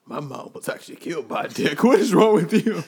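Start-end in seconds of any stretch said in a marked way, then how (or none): none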